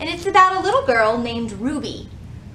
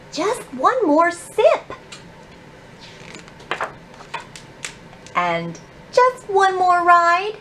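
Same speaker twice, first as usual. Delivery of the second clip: put-on higher, pleading child's voice; whiny little girl asking for more; little girl Ruby; 9 semitones higher, 3 dB louder than usual. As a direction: beg like a small girl who always wants more.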